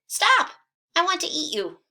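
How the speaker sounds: high, loud hungry pig; hungry pig shouting at the gingerbread man; shouted. Acted. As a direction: squeal it like a greedy pig. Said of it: "He saw the ... man and shouted".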